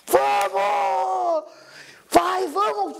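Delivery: high, drawn-out excited "let's go" yell; urgent rallying shout. Retold or imitated. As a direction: yell to get the driver moving. Acted urging the driver to leave.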